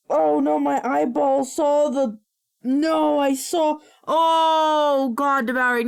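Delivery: disgusted panic. Real, not acted. Reacting to gross image.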